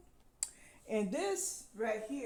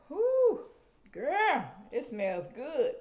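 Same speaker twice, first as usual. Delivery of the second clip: delighted whoo; 7 semitones higher, 8 dB louder, much slower than usual. Real, not acted. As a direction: cry out in delight at the scent, then savor it slowly. Smelling the candle, delighted.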